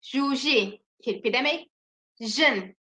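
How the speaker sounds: ordinary delivery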